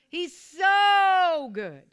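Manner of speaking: slow, stretched shout with a long so; say it like you mean it. Shown as a demonstration. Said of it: "you gotta say it like you mean it".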